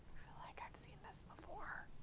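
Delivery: quiet whispered remark. Real, not acted. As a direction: breathe it out in a whisper. Whispered aside while streaming.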